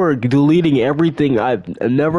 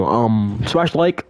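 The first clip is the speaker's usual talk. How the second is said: ordinary talk, nothing marked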